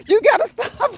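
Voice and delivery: high-pitched plea through laughter; squealed, helpless with laughter; laughing plea. Real, not acted. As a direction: beg them to stop while cracking up. Begging through laughter at chat.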